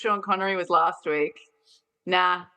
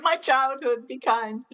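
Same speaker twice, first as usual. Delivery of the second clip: higher, wounded plea; pleading; 6 semitones higher, slower than usual. Retold or imitated. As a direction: beg them to be gentle. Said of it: "You'd be like".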